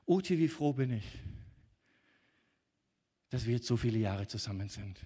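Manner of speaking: ordinary delivery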